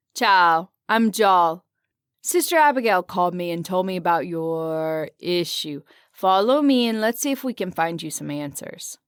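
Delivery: a cheery Italian guard introducing himself; Italian accent. Acted. Jal greets the stranger at the Colosseum, Italian accent. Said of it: "in a heavy Italian accent".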